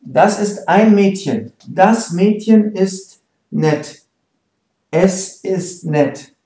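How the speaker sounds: slow, careful German for learners; teacher's slow demo; slow model sentences. Shown as a demonstration. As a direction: say each word slowly so students follow.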